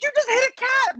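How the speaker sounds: very high, appalled accusation; horrified mother. Retold or imitated. Mother accusing father at the wheel, high horrified cry. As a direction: mimic a mother shrieking in horror. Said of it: "my mom's like".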